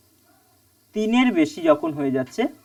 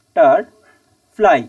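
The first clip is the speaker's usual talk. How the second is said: "Butterfly" in three separate pieces with long pauses between; syllable by syllable; 4 semitones lower, 5 dB louder, much slower than usual. Shown as a demonstration. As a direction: break "butterfly" slowly into বা-টার-ফ্লাই. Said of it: "এই তিনটে". ("Butterfly" তিন ভাগে থেমে থেমে, ধীরে)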